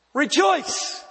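sudden high, ringing cry; exclaimed command. Retold or imitated. Pastor voicing Jesus telling the persecuted to rejoice, ringing exclamation. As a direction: fling the word out like Jesus's outburst. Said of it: "But he said".

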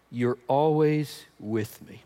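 slow, soft, reassuring; gentle reassurance. Shown as a demonstration. Father quietly reassuring his older son, slow and gentle. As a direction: lay it on gently, slowly. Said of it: "Isn't that the tone?".

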